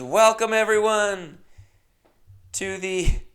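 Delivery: high, slow emcee welcome; host on the mic. Retold or imitated. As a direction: boom it out like a party host. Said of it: "And she goes".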